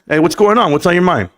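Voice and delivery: loud, pumped live-stream intro; hyped show host. Acted. Host hyping up his live viewers, loud and pumped. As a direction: fire up the chat like a hype host.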